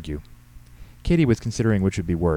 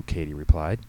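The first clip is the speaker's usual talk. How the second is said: plain reading voice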